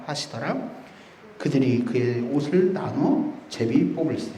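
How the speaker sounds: low, soft, measured Bible recitation; scripture reading. Acted. Preacher leading the congregation in a verse, low solemn reading.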